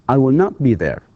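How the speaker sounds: not leaned on in I will not be there, falling at the end; teacher stressing the negative. Shown as a demonstration. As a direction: punch the word not. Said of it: "This is a, uh, negative".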